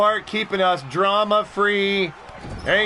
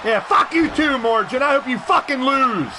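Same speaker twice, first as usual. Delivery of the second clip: raised heel insult; mock hostility; 5 semitones higher than usual. Heel host curses a chat member, raised and angry. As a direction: curse them out as a wrestling villain.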